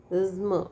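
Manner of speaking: only the low "zm" end of "प्रिज्म"; second syllable isolated. Shown as a demonstration. Teacher isolating the schwa syllable, only the ending sounded. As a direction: say just the buzzing last syllable of "प्रिज्म", zm with schwa. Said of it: "Two syllable, there is schwa".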